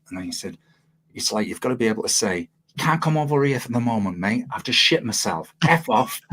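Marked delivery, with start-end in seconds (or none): 1.1-6.2 high mock Aussie accent; poor Australian accent. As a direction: imitate an Australian bandmate, pitched up. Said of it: "poor Australian accent time".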